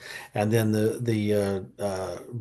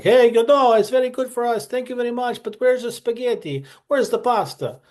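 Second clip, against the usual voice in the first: much higher, hammed-up fake Italian accent all through; horrible Italian accent; 12 semitones higher than usual. Italian prisoners leaving comments, in a hammed-up Italian accent. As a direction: ham up a bad Italian accent for laughs. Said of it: "for that horrible Italian accent".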